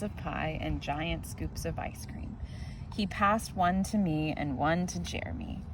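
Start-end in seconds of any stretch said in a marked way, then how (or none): none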